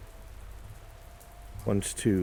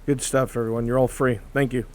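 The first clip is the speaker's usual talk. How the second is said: loud, higher call to a group; 4 semitones higher, 6 dB louder than usual. Acted. Telling everyone they are free, calling out. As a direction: announce it to everyone, voice raised.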